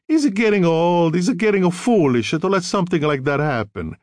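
mock her husband in an Italian accent; disrespectful. A narrator's reading. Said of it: "She tapped her head disrespectfully".

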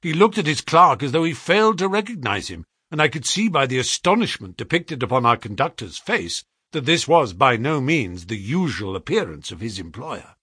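ordinary delivery

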